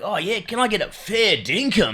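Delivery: slow, put-on broad Australian; laying the Aussie on thick while traveling; exaggerated Aussie accent. Comic abroad hamming up his Australian accent, slow and broad. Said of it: "I put it on a bit more".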